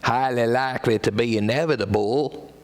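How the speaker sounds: a light cowboy twang on "Highly likely to be inevitable"; lightly voiced cowboy; cowboy accent. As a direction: pretend cowboy drawling it out. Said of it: "If he was a cowboy".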